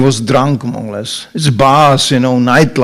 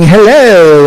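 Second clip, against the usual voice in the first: street lady's hello; 5 semitones higher, 6 dB louder than usual. Retold or imitated. Voicing ladies answering the greeting.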